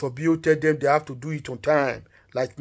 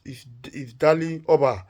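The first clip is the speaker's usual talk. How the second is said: usual speaking voice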